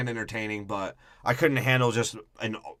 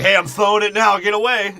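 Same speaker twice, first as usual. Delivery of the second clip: much higher and louder, a hammed-up shout to get away; mock robot warning; 9 semitones higher, 11 dB louder than usual. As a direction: yell the warning like a cartoon hero. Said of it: "he's like".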